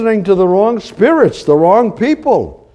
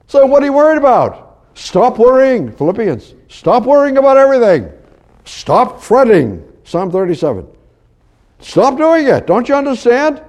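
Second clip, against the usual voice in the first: urgent exhortation; 4 semitones higher than usual. Acted. Urgent exhortation to stop worrying.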